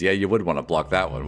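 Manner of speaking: normal talking voice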